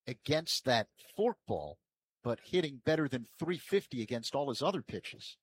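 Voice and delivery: usual speaking voice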